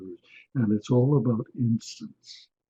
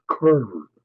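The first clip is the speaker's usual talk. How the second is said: ordinary delivery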